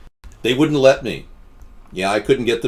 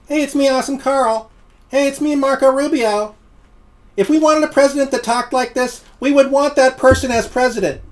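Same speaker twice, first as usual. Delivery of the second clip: much higher goofy put-on voice; Awesome Carl impression; 13 semitones higher, 3 dB louder than usual. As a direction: play a dopey cartoonish politician. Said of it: "If I had Awesome Carl go".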